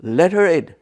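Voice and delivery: loud commanding man's voice, mildly imitated; big manly voice. Chief architect calling loudly from his office. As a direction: boom it out like the boss behind the door. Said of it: "I hear a very manly, big voice saying".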